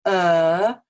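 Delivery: a loud, sustained "uh"; long vowel. Shown as a demonstration. Teacher shows how to make it long, sustained. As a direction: hold the "uh" out long and steady. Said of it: "make it long".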